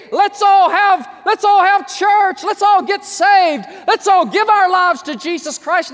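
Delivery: high, loud, amazed crowd voice; hammed-up eager believer, high and loud; excited exclamation. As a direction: cry out in wonder at the signs. Preacher voices people reading Bible.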